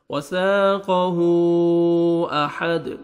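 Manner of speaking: slow melodic tajweed chant; Quran recitation. Shown as a demonstration. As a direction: recite the verse with full tajweed. Teacher recites Quran verse. (تجوید کے ساتھ تلاوت)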